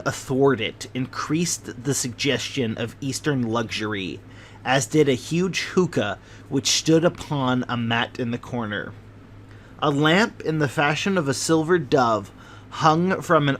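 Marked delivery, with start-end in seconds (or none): none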